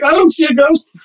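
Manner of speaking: high-pitched, hammed-up frantic reply; mock panic. Guest answering his screaming roommate, mock panic.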